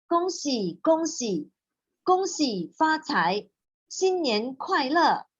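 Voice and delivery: lifted, slow festive greeting; cheerful intonation demo. Shown as a demonstration. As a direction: show the pleasant singsong way to say it. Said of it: "use a better intonation to read? You see how 老师 do?".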